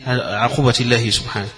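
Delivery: ordinary talk, nothing marked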